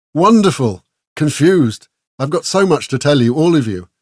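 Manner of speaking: high, bubbling excited answer. A narrator's reading. Esmeralda answering Dax on his return, excited. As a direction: gush out the news.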